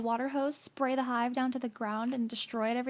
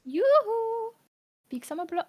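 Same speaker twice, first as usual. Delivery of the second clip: higher, sing-song jolly shopkeeper; Frozen shopkeeper voice; 9 semitones higher, slower than usual. Retold or imitated. Friend repeats the Frozen shopkeeper line, higher. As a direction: call it out like a cheery trader. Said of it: "the guy from Frozen where he's like".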